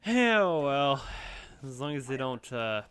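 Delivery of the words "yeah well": louder, higher mock retort. Real, not acted. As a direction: throw it back loud and high. Grumbling at the game.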